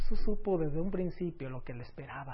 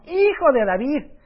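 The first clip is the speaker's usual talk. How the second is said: higher, louder pleading cry; desperate cry; 5 semitones higher, 8 dB louder than usual. Acted. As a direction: plead as a blind man shouting for mercy. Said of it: "imagínense los gritos de desesperación".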